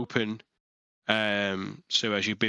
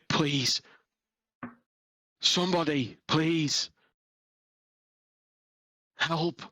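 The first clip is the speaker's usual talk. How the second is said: weak male voice begging, higher; a frail man pleading from below; frail voice calling for help; 6 semitones higher, slower than usual. A narrator's reading. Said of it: "but it sounds like a frail voice".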